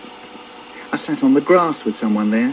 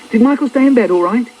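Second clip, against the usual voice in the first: high-pitched mum's voice; mum calling; 9 semitones higher, 4 dB louder, faster than usual. Acted. Mum checking at brother's door, high calling voice. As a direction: ask it brightly through a door.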